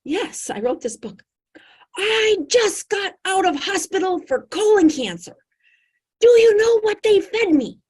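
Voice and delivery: high, loud, indignant storytelling; a big personality outraged by hospital food; animated woman. Retold or imitated. Patient tells the doctor her hospital story, high and loud.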